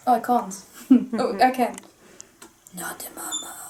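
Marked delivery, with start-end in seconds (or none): 2.8-3.6 hushed, unvoiced whisper; whispered catchphrase. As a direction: whisper it as a sneaky little quote. Quotes the character's catchphrase quietly.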